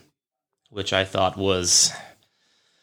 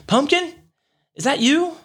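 much higher, sweet call of Pumpkin; imitating girlfriend. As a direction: play the girlfriend calling out, higher. Girlfriend calling through locked door.

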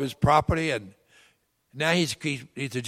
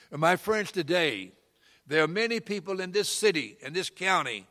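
slowing, louder preaching climax; 3 semitones higher, 7 dB louder than usual. Acted. Preacher's closing appeal.

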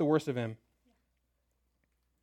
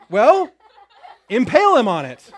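loud, higher verdict through a laugh; laughing king; 5 semitones higher, 11 dB louder than usual. Acted. King orders Haman impaled, laughing and loud. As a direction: toss off the death sentence with a laugh.

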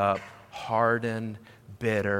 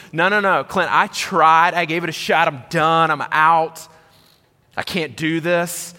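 protesting listener; 6 semitones higher, 8 dB louder than usual. Acted. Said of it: "maybe your response is".

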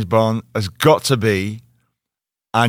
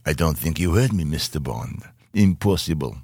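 low, soft Russian-accented villain; 6 semitones lower, 6 dB quieter than usual. Shown as a demonstration. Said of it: "do a Russian accent".

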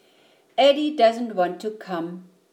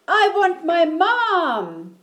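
high, loud, drawn-out wail; wailing child; 9 semitones higher, 5 dB louder than usual. Acted. Frightened Eddie wants Mom, wailing loudly.

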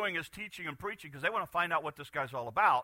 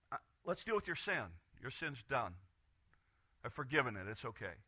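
soft, tender reassurance, quieter; gentle Jesus; 3 semitones lower, 7 dB quieter than usual. Retold or imitated. Jesus gently reassuring the paralyzed man. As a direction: comfort him softly.

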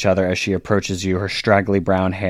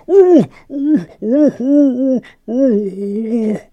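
high, loud moans through a stitched mouth; muffled demon whimpers; 5 dB louder, much slower than usual. Acted. Stitched demon tugged by the needle, high muffled moaning. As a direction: whimper wordlessly, high, mouth sewn shut. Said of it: "tugs on the needle and goes".

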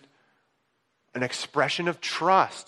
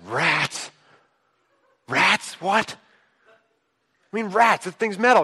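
shocked disbelief; 5 semitones higher than usual. Retold or imitated. Hearing rats ate stored stuff.

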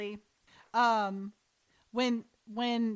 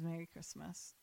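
low, hushed and tender; 6 semitones lower, 16 dB quieter than usual. Retold or imitated. Couple about to kiss, hushed tender wish. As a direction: say it softly to your love.

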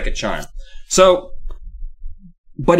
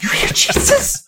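mock excitement; 5 semitones higher, 4 dB louder than usual. Imagined gullible psychiatrist, excited.

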